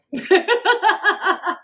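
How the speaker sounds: high-pitched giggling burst; real high laugh; amused laugh. Real, not acted. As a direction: laugh at your own joke, high and quick. Laughs at own "Hey, bro.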